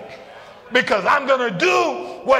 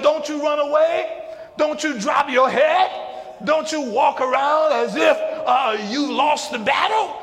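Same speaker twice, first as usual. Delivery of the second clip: full-force preaching; 4 semitones higher than usual. Acted. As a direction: build the sermon toward the climax. Preacher voicing God, building.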